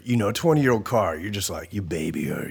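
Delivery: ordinary delivery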